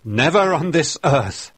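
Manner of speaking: sudden loud high cry of refusal; the old reverend's loud defiant cry; cried out. A narrator's reading. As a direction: fling the word out with dying strength. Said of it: "he cried".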